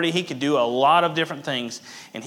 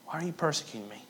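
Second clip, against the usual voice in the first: much softer, almost breathed; hushed repeat; 10 dB quieter, much faster than usual. Acted. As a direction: let the question fall to near silence. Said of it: "He cries out".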